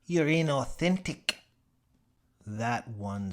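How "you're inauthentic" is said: high, louder Tasmanian jab; sting him with the word; accusing Gadsby. Acted. Gadsby accusing Mitchell, high, louder Tasmanian voice.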